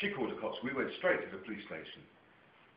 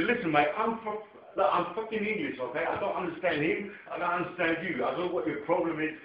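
heated argument; 7 semitones higher, 3 dB louder than usual. Retold or imitated. Arguing with old man's son.

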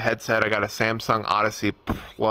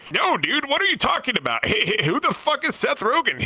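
much higher, chuckling celebrity impression; Seth Rogen; 13 semitones higher than usual. Guest teasing people who requested the voice, high chuckling impression. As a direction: play it incredulous in the impression, laughing between phrases. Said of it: "can you do the Seth Rogen voice".

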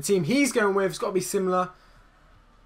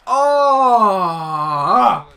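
loud groaning cry; 8 dB louder than usual. Real, not acted.

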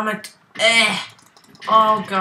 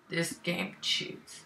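slow, much softer mutter; 2 semitones lower, 12 dB quieter, much slower than usual. Real, not acted. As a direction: mutter it slowly under breath.

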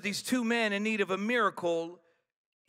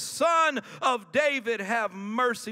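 raised, drawn-out preacher's plea; retold crying out; 4 semitones higher, 8 dB louder than usual. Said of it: "they cried out to Jesus".

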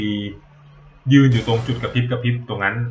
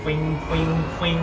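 higher, airy "ฟิง ฟิง ฟิง" charging noise; imitated charge-up sound; 6 semitones higher, 5 dB quieter than usual. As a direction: mimic the weapon powering up, pitched up. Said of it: "กดคลิกแช่แล้วชาร์จนี้". (เลียนเสียงชาร์จดาบ "ฟิง ฟิง ฟิง" เสียงสูงขึ้น)

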